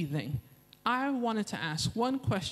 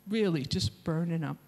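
normal talking voice

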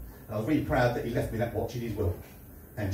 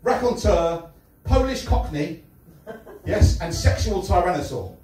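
a hint of a cocky lounge lothario, higher; preening self-introduction, a touch higher; smug showman persona; 7 semitones higher than usual. Stand-up character intro.

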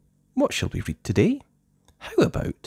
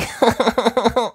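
giggle; 6 dB louder than usual. Acted.

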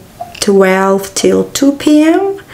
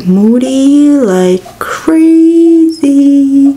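drawn-out, louder and higher; emphatic drawl; 5 semitones higher, 4 dB louder, much slower than usual. Real, not acted. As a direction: stretch every word to stress it. Stressing her moodiness.

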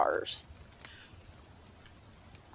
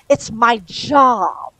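loud, drawn-out insistence; indignant protest; 5 semitones higher, 7 dB louder, much slower than usual. A narrator's reading. Angel defending himself to Crowley, indignant. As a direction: protest it, stretching the words right out.

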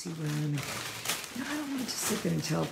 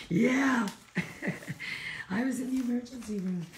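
high, loud, laughing mock Ruben; 9 semitones higher, 4 dB louder than usual. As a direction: tease him in his voice. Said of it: "he's like".

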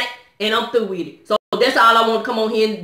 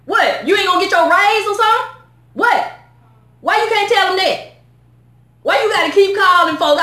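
higher, scornful mock questions; mocking the caller; 7 semitones higher than usual. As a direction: sneer at the collector's excuses. Mocking the bill collectors.

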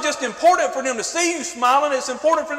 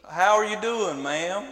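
soft, lower Southern drawl, much quieter; sweet southern voice; 6 semitones lower, 7 dB quieter, slower than usual. Retold or imitated. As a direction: imitate a polite Southern gentleman greeting a lady, softly. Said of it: "He's got that sweet southern voice".

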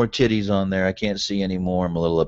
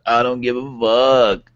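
slow, drawn-out, louder; put-on voice; 4 semitones higher, 3 dB louder, slower than usual. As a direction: stretch each word out lazily. Repeats line in put-on voice.

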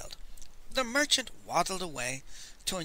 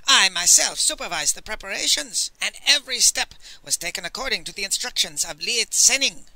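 louder, indignant self-defence; raised, offended assertion; affronted; 5 semitones higher, 5 dB louder than usual. A narrator's reading. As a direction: insist on it, pride wounded.

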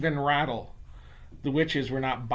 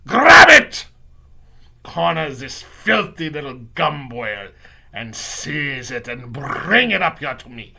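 loud, commanding villainess voice; fierce witch shouting, drawing out her words; Grand High Witch; 12 dB louder than usual. Acted. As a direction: bellow orders to the coven. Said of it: "screamed the Grand High Witch".

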